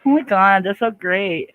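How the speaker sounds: thrilled by a viewer's kind words; excited squeal. Real, not acted. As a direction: burst out with delight at the compliment. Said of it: "I got a little excited".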